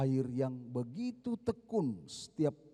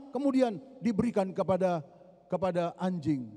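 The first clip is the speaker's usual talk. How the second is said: high, loud, driving sermon retelling; fiery preaching; 7 semitones higher, 3 dB louder than usual. Acted. Preacher retelling the Canaanite woman to the congregation, fiery and raised. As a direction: preach the story with full force.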